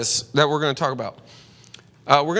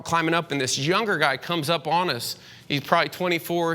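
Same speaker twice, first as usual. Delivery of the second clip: ordinary delivery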